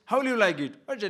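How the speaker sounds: ordinary talk, nothing marked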